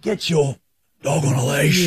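mock-fuming telling-off, voice lifted; retold fuming order. Fuming dog owner tells off the other owner, indignant. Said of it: "Now I'm fuming".

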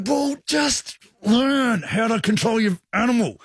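high, slow, drawn-out mock fury; exasperated telling-off. Bitten man tells dog owner off, exasperated. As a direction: stretch each word out in retold exasperation.

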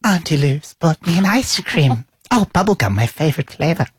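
lightly voiced small child; a hint of an eager little kid; imitating little Finn. Uncle mimicking Finn asking about ice cream, lightly voiced child. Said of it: "That's how he talks".

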